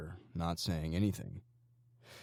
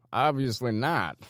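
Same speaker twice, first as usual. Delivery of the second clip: sarcastic; 7 semitones higher, 7 dB louder than usual. A narrator's reading. Gang member mocking the captive sarcastically. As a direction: laugh at the prisoner's bravado. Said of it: "Hoosier said sarcastically".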